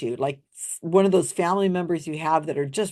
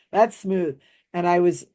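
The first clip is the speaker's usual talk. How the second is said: normal talking voice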